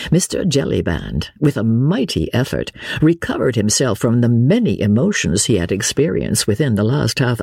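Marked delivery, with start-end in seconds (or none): none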